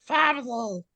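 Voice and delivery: high, squeaky Family; joking player mimics the high Family like Stitch; Stitch-like squeak. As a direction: squeak it like a little alien. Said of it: "It's more like Stitch".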